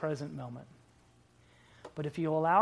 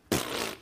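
scoffing pfft. Shown as a demonstration. Pastor teaching the congregation to say pfft, a lip puff. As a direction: puff it through the lips. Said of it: "you gotta get the lips moving".

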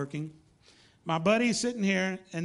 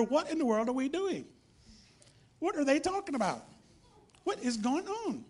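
higher-pitched, incredulous questions; imitated bewilderment; 6 semitones higher than usual. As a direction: voice baffled churchgoers wondering aloud. Voicing puzzled people leaving church.

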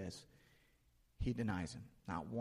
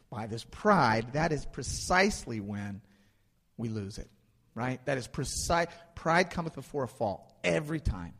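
louder, building sermon cadence; forceful preaching; 6 dB louder than usual. Acted. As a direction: drive the point home from the pulpit.

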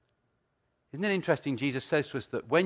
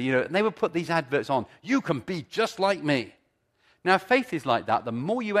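preaching; 4 semitones higher, 7 dB louder than usual. Acted. Preaching at full force.

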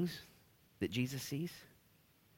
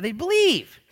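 emphatic shout; 14 semitones higher, 12 dB louder, slower than usual. Real, not acted. Stressing kids' faith.

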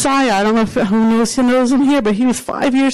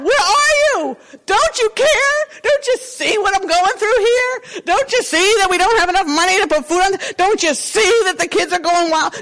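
desperate plea; 10 semitones higher than usual. Pretend. Worried believer crying out to God, high and desperate. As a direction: plead like a drowning man begging heaven.

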